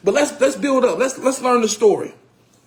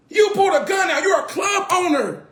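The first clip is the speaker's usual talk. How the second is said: outraged shout; 8 semitones higher than usual. Real, not acted. Comedian ranting at the owner who pulled a gun, outraged shout. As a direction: call him out at full voice.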